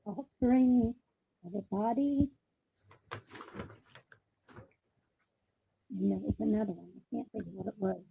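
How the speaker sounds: muffled, like through a wall; muffled bad connection. Real, not acted. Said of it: "You sound muffled".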